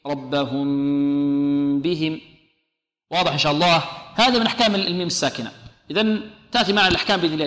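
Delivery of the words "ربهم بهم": very slow chant, M of "ربهم" held as a long hum before "بهم"; unpressed meem. Shown as a demonstration. Teacher repeating the correct way, long hummed M in "ربهم". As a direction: stretch the M of "ربهم" with relaxed lips. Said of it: "بإطلاق الشفتين بدون كز".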